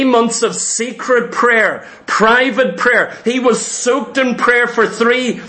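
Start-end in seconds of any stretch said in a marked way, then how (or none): none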